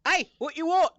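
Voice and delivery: British rabbit. Acted. British accent, rabbit picking a fight with the party. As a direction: snarl like a tiny British thug bunny. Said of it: "Went British again".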